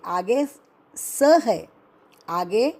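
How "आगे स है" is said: usual speaking voice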